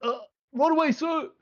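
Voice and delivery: very high, squeaky servant reply; a flustered underling yelping; a servant scrambling to obey Doran; eager servant. Acted. Servant answers order.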